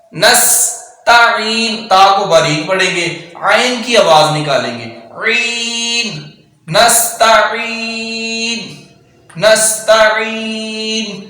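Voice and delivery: نستعین with a hissing seen and throaty ain; whistled seen. Shown as a demonstration. Teacher drilling seen and ain slowly. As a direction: hiss the seen, then press the ain. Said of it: "سین میں سٹی پیدا کریں گے".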